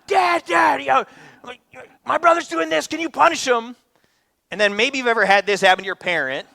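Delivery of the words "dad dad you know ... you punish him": high, loud whiny tattling; Dad, Dad, tattle to get him punished; child tattling. Retold or imitated. Nine-year-old daughter begging dad to punish brother, high whiny. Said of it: "my nine-year-old will come up".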